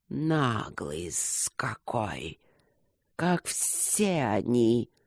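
low, very slow hissing voice; soft, drawn-out serpent disdain; sneering serpent. A narrator's reading. Serpents grumble about cheeky Luke, low slow hiss.

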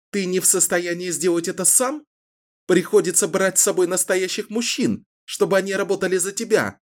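high, scornful mockery; defiant woman taunting. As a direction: needle him, sneering. Taunting the captor. (Кэлен издевается над главарём)

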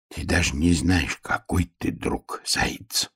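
soft, slowing to a whisper; тихо. A narrator's reading. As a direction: say it hushed, fading into breath. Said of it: "тихо сказал". (тихо, переходя на шёпот)

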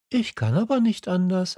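put-on high, quick child voice, bewildered; a boy caught off guard; little prince protesting, fast and high; confused little prince. A narrator's reading. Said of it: "entgegnete der kleine Prinz ganz verwirrt".

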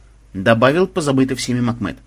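ordinary delivery

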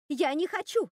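high, loud refusal; shouting. A narrator's reading. Руби refuses to show her hands, shouting. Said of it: "закричала Руби".

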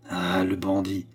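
mild low growl at bandit. A narrator's reading. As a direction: grumble "bandit" under the breath. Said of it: "gronda-t-il". (grondement sourd sur « bandit »)

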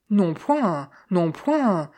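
mild exclamation, each falling away; exclaimed refusal. A narrator's reading. Insurance agent refuses the banknotes, mildly exclaiming. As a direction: wave it off, pitch dropping on each refusal. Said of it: "s'écria-t-il".

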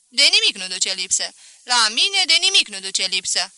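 loud, insistent questioning; indignant merchant. Acted. Merchant demands to know what his wife lacks, loud and indignant. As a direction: press the listener for an answer, loudly.

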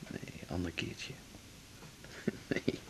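slow soft whisper. Acted. Man declining his lover in bed, slow whisper. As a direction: turn her down gently in a whisper.

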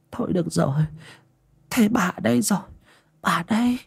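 put-on soft, high, tender old voice; soothing grandmother. A narrator's reading. Grandmother strokes crying granddaughter's head, softly soothing. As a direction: comfort the child quietly and gently.

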